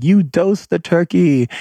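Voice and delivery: high, slow, playful accusation; mock accusing. Family at the table accusing him over the turkey, playful. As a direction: tease him like you caught him. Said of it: "And they're like".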